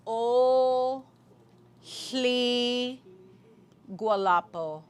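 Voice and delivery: O hli gualapo, each part said slowly and strongly; greeting a crowd, syllable by syllable; Lushootseed greeting. Shown as a demonstration. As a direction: call out O, then hli, then gualapo. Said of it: "So this first part".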